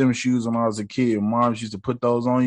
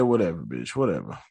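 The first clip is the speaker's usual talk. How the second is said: mock dismissive; 6 semitones lower, 7 dB quieter than usual. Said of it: "Steven was like".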